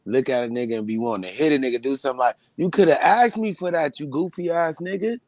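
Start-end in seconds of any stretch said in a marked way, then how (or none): 2.6-5.2 half-joking heated rebuke; mock scolding. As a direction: scold the petty thief you'd like to hit. Acting out confronting a thief.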